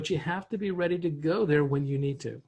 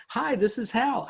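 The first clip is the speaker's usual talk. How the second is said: higher-pitched matching phone hello; mirrored greeting; 7 semitones higher than usual. Shown as a demonstration. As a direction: mirror the caller's perky greeting. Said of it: "I would mirror and match what I'm hearing".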